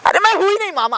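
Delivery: high, exasperated protest; heated, high-pitched rebuttal; vehement denial. Acted. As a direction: disown the title with a pitch leap. Angrily disowning the uncle title.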